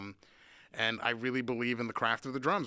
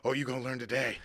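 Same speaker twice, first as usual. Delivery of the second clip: mock ominous warning; faster than usual. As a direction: taunt the sound guy, chuckling. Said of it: "it's like".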